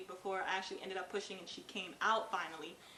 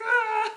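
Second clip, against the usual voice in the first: straining cry; 12 semitones higher, 12 dB louder than usual. Retold or imitated. Pushing the baby out.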